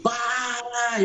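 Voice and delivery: high cry of para; shout. Retold or imitated. Preacher retelling crying out to the doctor, high mock shout. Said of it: "Eu gritei".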